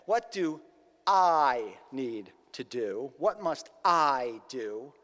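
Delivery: I stretched out, slow and leaned on; stress on I. Retold or imitated. Self-focused man asking Jesus, slow heavy stress on I. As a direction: hit the word I hard and draw it out. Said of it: "focused only on himself and on his own actions".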